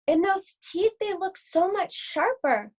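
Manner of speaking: put-on a girl gawking at huge arms; amazed little Red. Red marvels.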